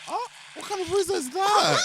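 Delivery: high, loud laughing question. Real, not acted. Laughing at disturbing sound.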